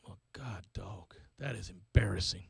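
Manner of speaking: hushed, breathy whisper to himself; embarrassed whisper. Retold or imitated. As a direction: whisper it like you're mortified.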